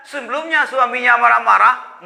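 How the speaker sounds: normal talking voice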